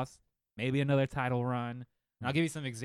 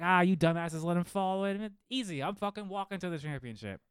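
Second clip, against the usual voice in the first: higher, cocky crowing; mock gloat, pitched up high; smug gloating; 7 semitones higher than usual. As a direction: crow like the title is yours.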